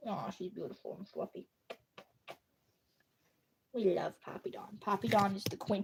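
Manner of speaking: soft, low fawning over a pet; hushed, doting praise; adoring coo. Real, not acted. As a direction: murmur fondly about a beloved cat.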